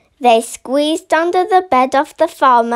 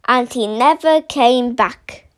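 usual speaking voice

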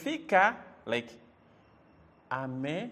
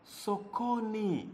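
SOKONI stretched out slowly, syllable by syllable; teacher drawing out "sokoni"; slow word demonstration; 4 semitones higher, 6 dB quieter, slower than usual. Shown as a demonstration. Teacher sounding out new word.

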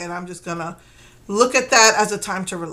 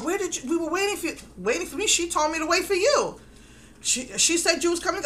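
higher-pitched, hurried mock protest; flustered retelling; 8 semitones higher than usual. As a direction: imitate a flustered back-and-forth, pitched up. Acts out flustered front desk exchange.

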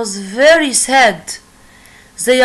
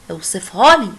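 usual speaking voice

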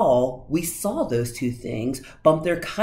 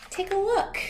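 very high playful cat; coy showman cat. A narrator's reading. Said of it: "said the cat".

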